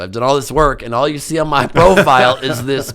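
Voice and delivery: excited. Real, not acted. Comic boast about body.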